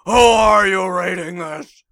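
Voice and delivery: loud gruff Batman growl. Pretend. Joking Batman's journal.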